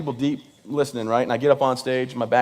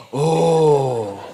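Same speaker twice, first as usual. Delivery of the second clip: long drawn-out "ohh" of dismay; crowd groan; 4 dB louder than usual. Retold or imitated. Imitating the crowd as he falls, long sympathetic groan. Whole crowd reacts to second fall.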